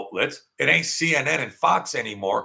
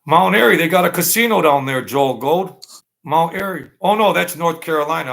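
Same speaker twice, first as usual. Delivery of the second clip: excited outburst; 6 semitones higher, 8 dB louder than usual. Real, not acted. Sudden excited recollection.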